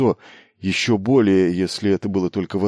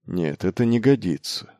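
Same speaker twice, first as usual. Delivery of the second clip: plain reading voice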